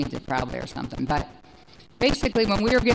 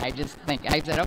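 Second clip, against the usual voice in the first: normal talking voice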